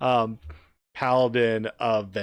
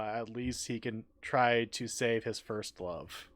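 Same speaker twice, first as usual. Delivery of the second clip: ordinary delivery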